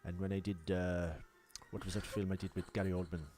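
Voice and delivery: low Welsh murmur from the back of the throat; lightly voiced old Welsh actor rambling; Anthony Hopkins impression. Pressed to keep going, a low Welsh lilt. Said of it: "Keep going".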